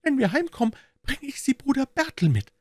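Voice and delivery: put-on very high child's voice, pleased; small girl eagerly planning a present; high-pitched, delighted little-kid chatter; happy little girl. A narrator's reading. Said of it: "rief die Kleine entzückt".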